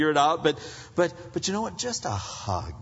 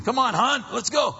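higher, mock-hurried call to the wife; impatient husband; 10 semitones higher, 3 dB louder than usual. Preacher imitating a husband rushing his wife, mock impatience. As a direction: hurry her along, half-joking.

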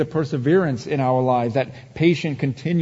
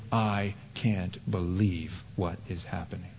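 soft, lower, muttered disbelief; mock disbelief; 7 semitones lower, 9 dB quieter than usual. Pastor voicing what believers say about the news, muted. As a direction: say it under your breath, bewildered.

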